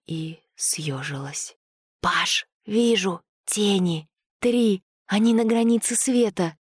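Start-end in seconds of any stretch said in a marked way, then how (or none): 2.0-6.6 tense, halting alarm call; frightened alarm. A narrator's reading. Girl warning her friend of approaching shadows, frightened alarm. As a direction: call out what you spot, scared.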